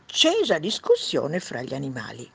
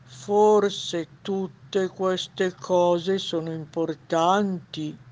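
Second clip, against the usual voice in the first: slow, drawn-out wise owl; 3 semitones higher, 5 dB louder, much slower than usual. Acted. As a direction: ponder it slowly as the sage old owl. Wise owl voice in read story. (voce lenta da gufo saggio)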